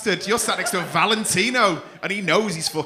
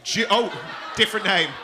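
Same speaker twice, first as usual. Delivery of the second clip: normal talking voice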